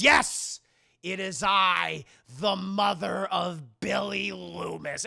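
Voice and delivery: loud, slow grand reveal; dramatic villain reveal. Retold or imitated. Mrs. Loomis revealing herself, loud and slow. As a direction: declare it like a melodrama villain. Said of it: "she goes".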